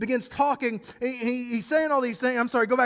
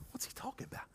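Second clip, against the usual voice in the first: whispered aside; 22 dB quieter, much faster than usual. Retold or imitated. Woman's confused inner thoughts.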